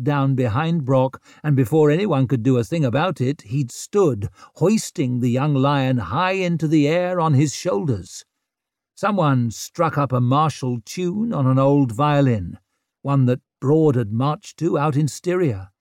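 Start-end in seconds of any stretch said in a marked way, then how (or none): none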